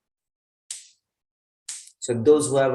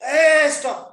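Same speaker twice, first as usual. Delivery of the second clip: high-pitched shout, louder on the last call; 13 semitones higher, 4 dB louder than usual. Acted. Protective adviser to someone walking into danger, shouting. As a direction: shout an urgent order to stop. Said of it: "He's shouting".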